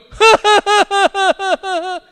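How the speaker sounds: high, loud put-on cackle; long forced string of ha-has; imitated fake laugh. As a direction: imitate a toddler forcing laughter. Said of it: "somebody starts laughing and he'll go".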